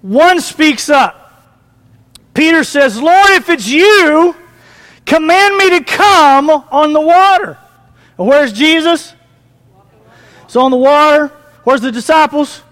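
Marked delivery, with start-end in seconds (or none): 3.0-7.5 higher, drawn-out acted plea; Peter's slow, raised-pitch call to his Lord. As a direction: call slowly across the water, lightly acted. Acting Peter calling to Jesus.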